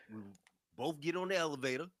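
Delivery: usual speaking voice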